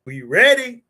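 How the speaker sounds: rallying question to the chat; hype call. Real, not acted. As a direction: pump the audience up. Hyping up the show start.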